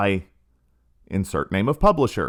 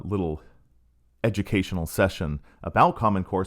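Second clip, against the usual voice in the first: ordinary delivery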